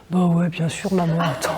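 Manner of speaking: put-on low-pitched teen brushing off mum; mock teenager, pitched well down; voiced teenage son. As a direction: imitate a son's offhand reassurance. Son's answer voiced by mother.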